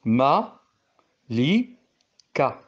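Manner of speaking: Ma, li, ka said as three separate beats; syllables split. Shown as a demonstration. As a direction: break Malika into Ma, li, ka with pauses. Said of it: "est formé de trois syllabes".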